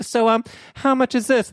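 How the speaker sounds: high, overly casual ask; innocent high voice. Retold or imitated. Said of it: "I was like".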